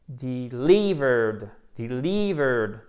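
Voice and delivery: slow "delivered", LI stretched out; accent tonique appuyé. Shown as a demonstration. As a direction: drag out the stressed LI in "delivered". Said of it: "Accent tonique, faites bien attention ici". (« delivered » lent, LI allongé)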